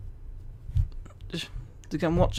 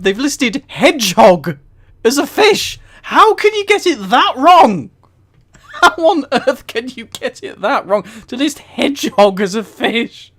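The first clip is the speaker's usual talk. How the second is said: much higher and louder, disbelieving; incredulous outburst; 6 semitones higher, 6 dB louder than usual. Real, not acted. To chat, amazed that a hedgehog is listed as a fish, incredulous outburst. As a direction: cry out how absurd it is.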